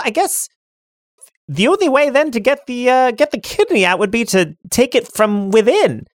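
high cackling witch greeting. Acted. GM as the Dimensional Witch, high and gleeful.

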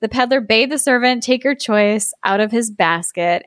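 normal talking voice

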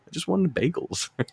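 mock-bewildered little complaint; imitating bystander girl. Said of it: "point just like".

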